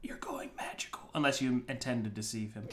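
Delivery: hushed whisper. Real, not acted. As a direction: whisper it so no one hears. Table whispers an aside.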